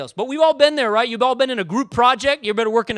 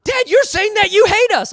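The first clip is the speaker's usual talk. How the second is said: put-on loud, much higher kids accusing dad; mock outraged children, pitched way up; indignant kids; 12 semitones higher, 8 dB louder than usual. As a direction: kids turning on father, loud and high. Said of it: "they were like".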